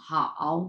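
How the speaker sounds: "হাও" low and dipping; third tone. Shown as a demonstration. Teacher showing learners the second word, low third tone on "হাও". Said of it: "হ্যাঁ, তিন number tone".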